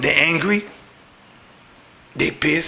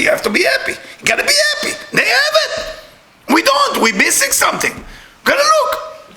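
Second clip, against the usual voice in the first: fervent high exclaiming. Real, not acted. Preaching that joy is commanded.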